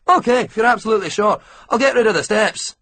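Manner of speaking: chatty Scottish mechanic offering tea and shortbread; bright, hurried Scottish hospitality; Scottish accent. A narrator's reading. Scottish ground worker talking.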